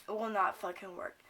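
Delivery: usual speaking voice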